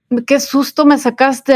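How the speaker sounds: mild reenacted outburst after a scare; retold yelling. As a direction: replay a startled telling-off. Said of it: "les grité".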